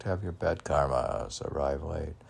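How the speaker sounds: ordinary talk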